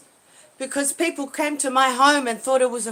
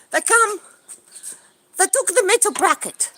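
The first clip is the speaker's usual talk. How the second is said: ordinary delivery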